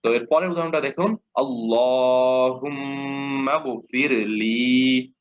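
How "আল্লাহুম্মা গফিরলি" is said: slow recited আল্লাহুম্মা গফিরলি with heavy lam; Quran recitation. Shown as a demonstration. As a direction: chant it slowly like tilawat. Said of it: "মোটা করে পড়ছেন".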